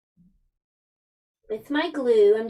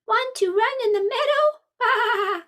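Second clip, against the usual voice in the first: high-pitched sheep character voice; bleating sheep; 12 semitones higher than usual. A narrator's reading. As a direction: speak high like a sheep, ending on a quavering bleat. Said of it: "bleated the sheep".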